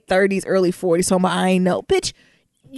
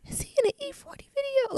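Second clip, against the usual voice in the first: incredulous quote; 9 dB quieter than usual. Retold or imitated. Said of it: "I be like".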